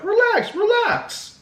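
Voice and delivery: high falsetto soothing a friend; mock calming-down in a squeaky pitch; exaggerated high-voiced imitation; Jamal. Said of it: "this man, Jamal's like".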